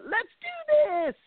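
high, slow cracking voice, trying to be brave; voice-crack cry. Retold or imitated. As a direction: let the voice break on a forced cheer. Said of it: "there's the voice crack crying kind of thing".

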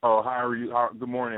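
usual speaking voice

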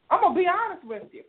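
usual speaking voice

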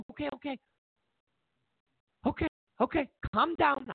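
high-pitched hasty giving in; mock flustered surrender. Badgered voter backing down, high mock surrender.

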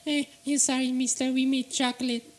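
voiced workers. Voicing the workers.

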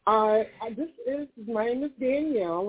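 normal talking voice

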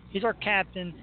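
ordinary talk, nothing marked

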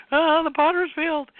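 high-pitched mock wail; mock crying. Recalling herself crying over the potter's field, mock high wail. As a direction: wail it high, imitating your own upset. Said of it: "when I was crying".

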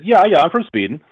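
lightly imitated Swedish lilt; Swedish accent. Retelling a Swedish actor's talk show answer, in a Swedish accent. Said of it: "And he said ... what made his accent".

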